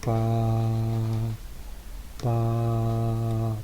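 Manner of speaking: slow, even pa syllables; slow demo pulse. Shown as a demonstration. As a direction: tap out a slow steady pulse. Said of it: "Si lo hago lento". (pa lento y parejo, marcando el pulso)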